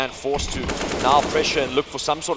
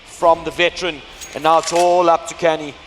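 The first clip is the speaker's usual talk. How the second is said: esports caster; 4 semitones higher, 5 dB louder than usual. Acted. Continues hyped play-by-play.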